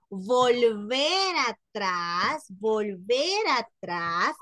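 very slow, drawn-out words for emphasis; énfasis pausado. Real, not acted. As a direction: stretch each word out slowly for the class. Profesora recalca repasar lo visto. (énfasis lento, alargando las palabras)

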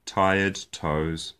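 ordinary delivery

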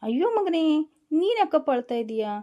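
high, loud alarmed Ayyo to her son; dismayed cry. Acted. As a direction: gasp out the son's name in alarm. Mother sees son crying.

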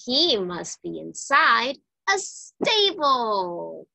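ordinary delivery

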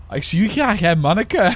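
put-on Flemish accent with a laugh; chuckling through a mock Flemish Ik zie u graag; playful mock Flemish with a laugh. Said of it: "Shout-out naar alle Vlaamse kijkers".

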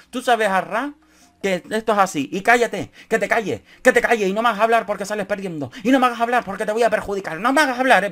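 high, mock threatening rant; mock menacing rant, building. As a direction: imitate his menacing warning. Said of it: "así, con un tono amenazante".

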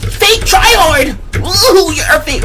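very high squealed jeer; mocking falsetto taunt. Gamer jeering at a fake tryhard, falsetto mockery.

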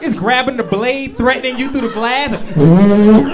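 loud, building to a yelled no; hammed-up blender panic; mock alarmed yell. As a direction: shout the warning in fake panic. Blender kale threatening.

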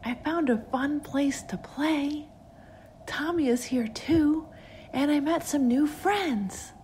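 put-on high, slow child voice; singsong lost child; eerie child's voice. A narrator's reading. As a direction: draw it out high like a ghostly little girl. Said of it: "the voice responded".

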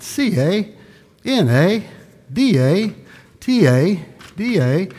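high, drawn-out letter call; hammed-up letter-by-letter announcement; mock letter announcing.

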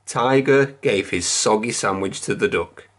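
plain reading voice

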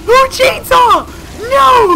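high-pitched yell, very loud; startled full-volume shriek; alarmed shout. Real, not acted. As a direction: scream it in a game panic. Alarmed by chainsaw enemy.

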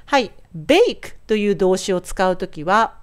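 normal talking voice

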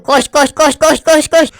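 rising urgent run, run; excited chant to run; urgent urging. Real, not acted.

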